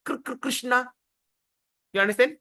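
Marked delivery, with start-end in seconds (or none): 0.1-0.8 "Kr, kr" stuck before "Krishna"; mock drunk stutter on "Krishna"; drunken stammer. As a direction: stumble twice on the first sound of "Krishna". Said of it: "He can't even pronounce the word properly".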